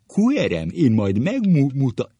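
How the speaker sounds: high hammed-up teacher shout; corrected teacher impression. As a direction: demonstrate it emphatically, with swollen vowels. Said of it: "így mondta".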